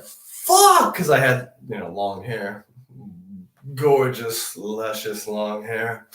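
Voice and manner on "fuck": yelp. Retold or imitated. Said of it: "And I was like".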